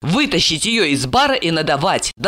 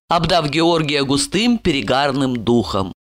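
plain reading voice

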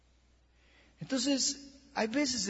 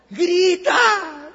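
a full shout, much higher and louder; preacher's shout; 10 semitones higher, 11 dB louder than usual. Acted. Preacher to church, acting a drowning man: shouting. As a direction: cry it out like someone going under.

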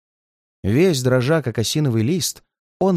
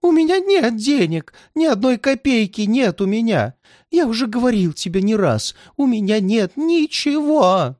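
put-on high, plaintive old man's begging; pleading old man; 9 semitones higher than usual. A narrator's reading. Old man begs the redhead for mercy, high and plaintive. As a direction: beg for mercy in a much higher voice. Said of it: "жалобно молил старик".

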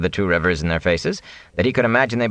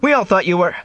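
high raised call, cut off; shout; 12 semitones higher, 4 dB louder than usual. A narrator's reading. Rand calls to the ragged peddler he knows, shouting. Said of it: "he shouted".

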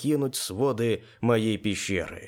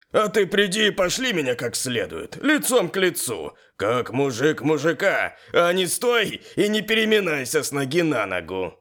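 higher, jeering character voice; Yuri, smirking; 5 semitones higher, 4 dB louder than usual. A narrator's reading. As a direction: taunt him with a raised, goading voice. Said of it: "усмехнулся Юрий". (с громкой издёвкой, усмехаясь)

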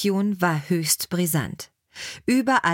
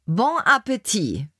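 a lightly voiced, cheerful Julia Child, higher; 4 semitones higher than usual. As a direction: toss off a bright sign-off as the TV chef. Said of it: "sagte sie".

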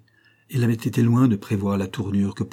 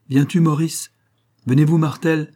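brightly raised, higher call; 6 semitones higher, 4 dB louder than usual. A narrator's reading. Doctor rounding up the others, calling out. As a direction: round up your companions with a lifted voice.

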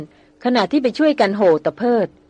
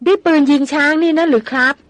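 high, loud disbelieving exclamation; astonished cry; 5 semitones higher, 3 dB louder than usual. A narrator's reading. Hunter startled by the lady's elephant gun, astonished cry. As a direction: blurt it out wide-eyed. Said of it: "ระพินร้องลืมตาโพลง".

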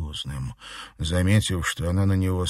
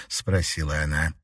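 ordinary delivery, nothing marked

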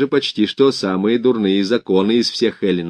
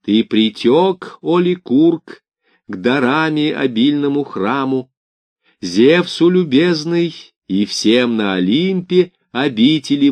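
slow, higher solemn oracle chant; prophetic trance voice; 4 semitones higher, slower than usual. Acted. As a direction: intone it slowly like a priestess. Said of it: "Пифия тотчас же изрекла ему вот что".

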